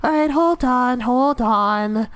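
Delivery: high, silly put-on voice; mock casual player. Streamer mimicking someone unhurried in a game, high mocking voice. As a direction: stall in a mocking falsetto. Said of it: "It's just like".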